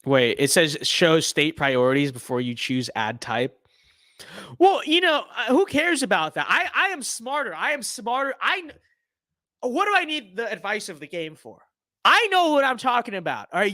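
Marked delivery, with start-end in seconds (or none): 4.6-13.5 cocky. Pretend. Mock arrogant rant at game.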